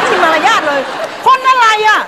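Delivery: high scolding shout; indignant shouting. Acted. Sister scolds brother loudly. (ตะโกนต่อว่าพี่ชายเสียงสูง)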